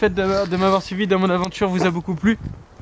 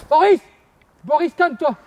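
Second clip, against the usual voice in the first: high, loud shouting at Boris; hammed-up panicked yell; frantic shout; 10 semitones higher, 7 dB louder than usual. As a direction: yell at him to calm down.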